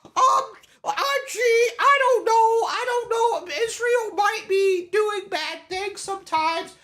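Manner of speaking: high, clueless woman's voice, put on and drawn out; mock her halting answers. Streamer mocks a clueless woman's answers, high and hammed-up. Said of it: "keep this woman away from me".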